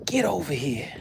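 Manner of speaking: breathy whisper, lightly voiced fish; playful whisper as the fish; whispered fish call. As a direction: hiss it like the fish is luring you. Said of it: "it was like".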